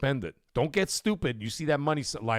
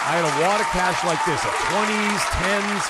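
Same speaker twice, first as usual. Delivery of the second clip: excited boast; 4 semitones higher, 5 dB louder than usual. Real, not acted. Excited boast about cash wad.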